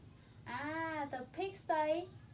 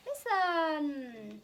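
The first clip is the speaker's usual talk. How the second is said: "Listen" with a long held ending; drawn-out; 3 dB louder than usual. Shown as a demonstration. Parent calling the child to hear the animal sounds, drawn-out. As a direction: stretch "Listen" out long, fading on the last syllable.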